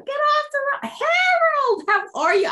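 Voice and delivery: high, loud, cartoonish yell; grumpy man mock. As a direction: ham up the angry old driver. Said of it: "he'll come down".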